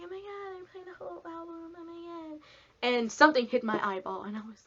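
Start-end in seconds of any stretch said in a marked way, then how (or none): 0.0-2.4 high breathy excited gasp at the band; squealing over the whole album; starstruck squeal. Retold or imitated. Excited fan at concert.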